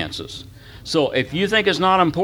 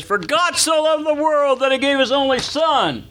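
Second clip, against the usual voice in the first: much higher, ringing Pentecostal preacher cadence; old-time preaching cadence; 11 semitones higher than usual. Retold or imitated. Son shows his preacher dad the exciting cadence, high ringing preaching. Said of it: "What if I said this?".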